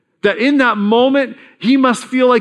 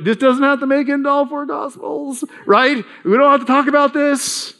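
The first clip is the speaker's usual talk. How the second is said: mock pleading Peter; 7 semitones higher than usual. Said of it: "I'd be like".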